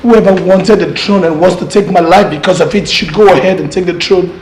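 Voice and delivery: loud upset declaration; upset outburst. Acted. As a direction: burst out that you're leaving. Pleading to leave the throne.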